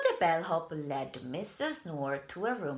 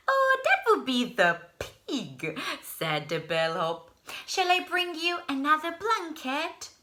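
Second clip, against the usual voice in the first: higher, louder helpful offer; cheery bellhop; 11 semitones higher, 5 dB louder than usual. Acted. Bellhop offering a blanket, cheerful. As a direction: offer it brightly.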